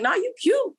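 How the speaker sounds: mock reassurance. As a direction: toss it back high and teasing. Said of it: "He was like".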